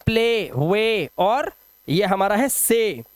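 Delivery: normal talking voice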